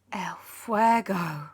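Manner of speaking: ordinary delivery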